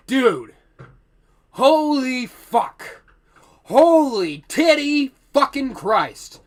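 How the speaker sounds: loud, high, slow appalled outburst; appalled shock. Retold or imitated. Worker hearing janitor never brushes, appalled outburst. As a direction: stretch it out in horror.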